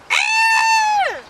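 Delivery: very high loud scream; pig scream. A narrator's reading. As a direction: shriek as the house falls. Straw house blown down.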